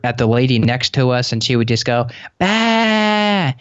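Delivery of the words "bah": put-on high squeaky baby "Bah" burst, much higher; baby's bah sound. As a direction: imitate a silly toddler blurting "Bah". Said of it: "and make this sound".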